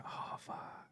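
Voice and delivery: panicked whisper. Retold or imitated. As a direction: breathe it out in a shocked whisper. Retelling panic at news article.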